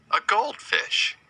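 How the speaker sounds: delighted high "A goldfish"; excited high repeat. Shown as a demonstration. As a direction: lift "goldfish" way up for the kids. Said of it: "It's a goldfish".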